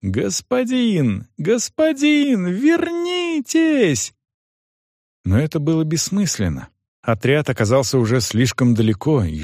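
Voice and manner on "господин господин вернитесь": high, drawn-out call to someone far off; high-pitched girl's shout, held back in volume; calling out desperately. A narrator's reading. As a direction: cry out after departing riders, stretching each word. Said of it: "Что было сил, она закричала".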